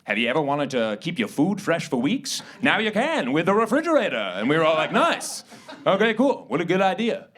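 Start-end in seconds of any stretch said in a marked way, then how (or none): 0.0-4.2 put-on old-time pitchman. Guy from G E pitching the refrigerator, hammed-up salesman. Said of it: "one guy from G E being like". 4.9-7.3 higher-pitched, hammed-up approval; mock delight. Everyone welcoming new technology, mock-delighted. Crowd reacting to the invention pitch.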